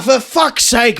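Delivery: ordinary delivery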